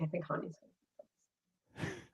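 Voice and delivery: very soft, staring-at-a-wall delivery; muted aside. Retold or imitated. Telling the dinner table who will propose, very quietly. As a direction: say it under your breath, almost to nobody. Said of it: "Like, just like this".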